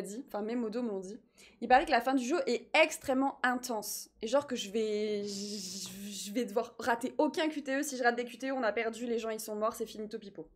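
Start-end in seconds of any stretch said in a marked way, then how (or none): none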